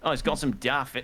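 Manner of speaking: put-on American doing a light Australian accent; Australian bloke accent. Co-host riffing on a bloke with his mates, light Australian accent. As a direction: play the bloke in a not-quite Australian accent. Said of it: "so close to getting a very good Australian accent".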